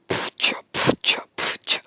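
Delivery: whispered beatbox puffs. Pretend. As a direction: spit out a breathy beat intro. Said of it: "here's a great rap".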